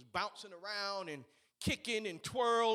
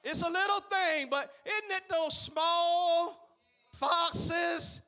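raised, loud preacher's cadence; preaching; 10 semitones higher, 11 dB louder, much slower than usual. Acted. Pastor to his congregation, preaching with loud fervor. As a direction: preach it out to the church. Opening sermon point, preaching.

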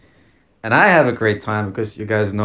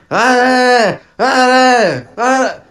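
high, loud imitated scream; loud mock shrieks; 14 semitones higher, 7 dB louder, much slower than usual. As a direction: mimic her screams in repeated high bursts. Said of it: "screaming, and I'm like".

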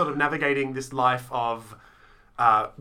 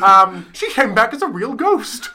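ditzy celebrity voice; 11 semitones higher, 11 dB louder than usual. Pretend. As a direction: confide like a giddy movie star. Mock celebrity interview answer.

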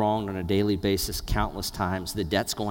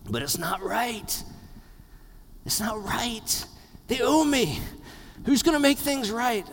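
pitched-up plaintive complaint; voiced inner protest; 11 semitones higher than usual. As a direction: protest in a high voice, rising as it goes. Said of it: "here's what you're thinking".

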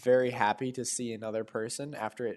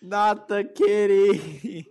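high, slow wail over the kitty; loud, drawn-out mock lament; mock dismay; 9 semitones higher, 8 dB louder, much slower than usual. Dismay at jaguar being hit.

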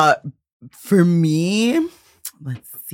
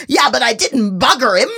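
quoting Gina Gershon; 7 semitones higher than usual. Retold or imitated. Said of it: "Gina Gershon's like".

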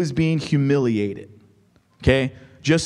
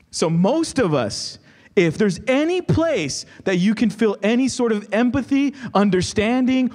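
higher, pleading sermon voice; impassioned preaching; 5 semitones higher than usual. Acted. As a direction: lift the voice as if sharing their doubt. Preaching with rising passion.

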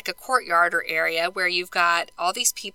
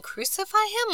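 retold crowd scream; 12 semitones higher, 4 dB quieter than usual. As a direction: imitate a screaming crowd without the volume. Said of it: "now they're screaming".

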